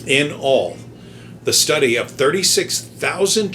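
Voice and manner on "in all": ordinary talk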